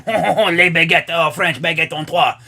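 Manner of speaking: caricatured French chortle and babble; mock French accent. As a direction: do a silly French person's accent. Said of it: "if I go to France and everyone walks in".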